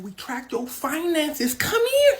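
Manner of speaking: high-pitched, insistent calling; mock summons. FBI summons Hush Puppy, high insistent order. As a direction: order him over, jumping high on the last words.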